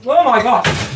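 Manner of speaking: high, loud cry of pain; loud exclamation. Real, not acted. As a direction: yelp it like burned mouth. Burning hot mouthful.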